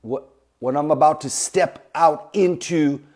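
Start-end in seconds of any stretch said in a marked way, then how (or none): none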